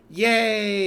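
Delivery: loud, high, gleeful yay; delighted cheer. Real, not acted. Player cheering at being made a bum, loud and gleeful.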